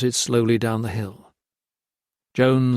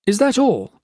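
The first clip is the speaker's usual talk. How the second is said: scornful question; 11 semitones higher, 4 dB louder than usual. Acted. Gray haggling over rations.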